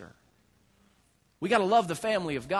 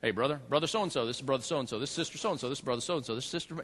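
Southern churchgoer introductions; 7 semitones lower, 7 dB quieter, faster than usual. Retold or imitated. Southern church people introducing one another, lightly imitated, low and soft. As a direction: lower and much softer, a hint of Southern church folk. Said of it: "They say".

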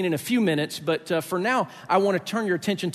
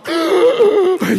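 loud, much higher gasp for air; surfacing gasp; 14 semitones higher, 10 dB louder than usual. Retold or imitated. Coming up from icy water, a loud, high gasp. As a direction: gulp air after the cold plunge.

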